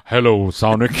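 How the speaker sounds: lightly voiced deep crooner.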